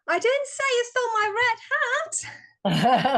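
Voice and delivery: high, fast and eager, ending in a laugh; loud and enthusiastic. Shown as a demonstration. Volunteer in a speaking workshop trying the sentence loud and enthusiastic. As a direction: throw it out with big energy, laughing at the end. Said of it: "so loud and enthusiastic?".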